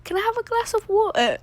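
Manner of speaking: hammed-up British voice, half laughing; horrible British accent. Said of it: "I'm gonna do my horrible British accent".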